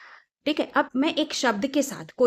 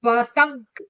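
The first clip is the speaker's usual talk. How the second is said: पतंग louder, through the held nose; 5 dB louder, much slower than usual. Shown as a demonstration. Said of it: "त के ऊपर हवा भरी ना". (नाक बंद करके पतंग)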